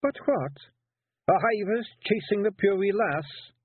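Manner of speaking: gruff little Scots warrior caught out; Feegle Rob. Acted. Rob defending himself to the lads, Scots pixie voice.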